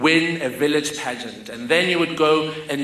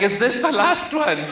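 higher questioning mother voice; a mother's raised, worried question; concerned mother; 8 semitones higher than usual. Retold or imitated.

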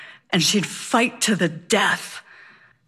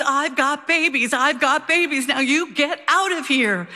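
higher mock battle cry; retold scream; 5 semitones higher than usual. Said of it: "and she screams".

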